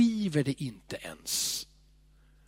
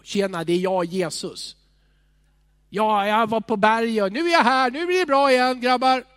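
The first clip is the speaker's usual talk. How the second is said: loud, higher, cheery buddy Jesus; hearty greeting; 5 semitones higher, 7 dB louder than usual. Retold or imitated. Jesus greeting his disciples, loud and jovial. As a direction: greet the lads like an old friend back from the hill. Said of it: "han säger".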